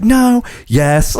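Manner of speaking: high-pitched mock fluster. Emily caught trying a new laugh, flustered. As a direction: imitate someone caught out and flustered, voice jumping up. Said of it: "like, all flustered".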